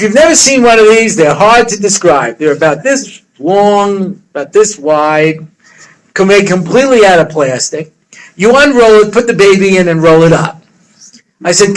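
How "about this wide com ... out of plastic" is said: ordinary talk, nothing marked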